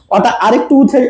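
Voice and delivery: ordinary delivery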